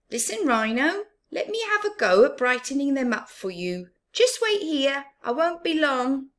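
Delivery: high, loud and fast; eager Baboon. Acted. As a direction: rush off to help a friend. Baboon voiced in story.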